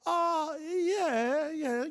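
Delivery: high, drawn-out noncommittal hedge; coy. Retold or imitated. Speaker dodging a developer's probing question, coy. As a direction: stall coyly with a high, loud "oh, yeah". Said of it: "I'm trying to be coy".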